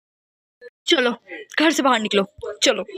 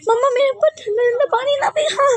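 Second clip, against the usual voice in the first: much higher, screaming and sobbing; crying shout; 10 semitones higher than usual. Acted. Girl screaming at mother as cold water is thrown. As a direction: shriek for mom to stop.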